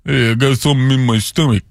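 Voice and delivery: thick-tongued boxer impression; Rocky Balboa. Host quoting the boxer to his co-host, put-on character voice. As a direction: do the movie boxer's voice. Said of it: "impersonation there".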